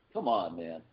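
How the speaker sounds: ordinary talk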